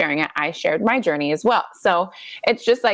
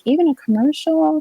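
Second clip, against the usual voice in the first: mock disbelief; 8 semitones higher than usual. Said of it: "I'm like".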